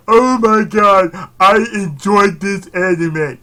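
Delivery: loud, slow, gushing mock fan. Vlogger mocking an anime fan, slow and loud.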